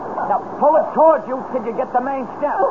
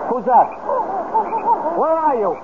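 calling out; 4 semitones higher than usual. Acted. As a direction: shout to find someone unseen. Man calls into dark swamp.

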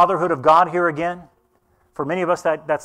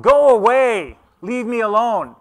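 higher, louder irritated shout from bed; grumpy sleeper; 6 semitones higher, 7 dB louder, slower than usual. Retold or imitated. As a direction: send the late visitor away, loudly. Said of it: "the guy in the bed who's going".

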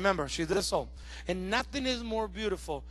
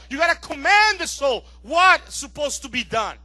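raised, forceful exhortation; full-force preaching; 7 semitones higher, 13 dB louder than usual. Real, not acted. Preacher urging congregation to command soul, high forceful preaching. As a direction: urge the congregation hard, pitched up.

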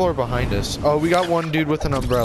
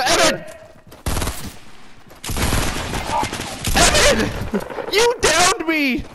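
outraged shriek; much slower than usual. Real, not acted. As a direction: scream at your teammate. Teammate shot him by accident.

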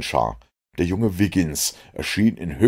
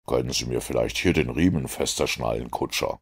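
calm low-voiced detective, measured; lower, deliberate Holmes voice explaining his plan; cool, composed Sherlock Holmes; 6 semitones lower, 4 dB quieter than usual. A narrator's reading. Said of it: "sagte er, ohne den Kopf umzuwenden".

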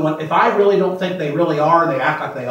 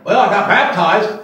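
mock proud claim; 4 semitones higher, 5 dB louder than usual. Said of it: "they're lost".

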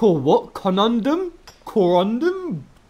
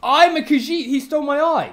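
excited; 12 semitones higher, 12 dB louder than usual. Real, not acted. Excited outburst.

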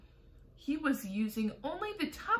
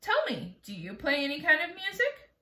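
ordinary delivery, nothing marked

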